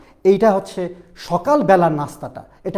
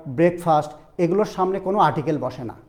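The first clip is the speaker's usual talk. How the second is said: ordinary delivery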